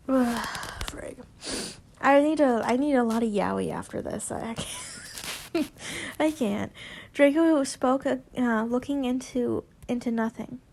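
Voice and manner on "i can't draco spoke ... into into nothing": usual speaking voice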